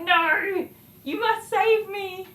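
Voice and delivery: high, slow, hammed-up cry for rescue; theatrical mock helplessness, drawn out; mock damsel plea. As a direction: beg to be saved like a silent-film heroine. Cowering behind son, mock plea.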